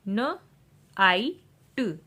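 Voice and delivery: न, long आई, ट, slow and separate; sounding out. Shown as a demonstration. Teacher showing IGH words, sounding out न, आई, ट. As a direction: break it into न, आई, ट. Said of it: "आई का जो vowel sound long".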